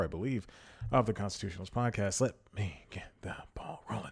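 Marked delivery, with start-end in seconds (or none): 2.2-4.1 unvoiced hushed whisper. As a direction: breathe the words with no voice. Mock dramatic opener aside.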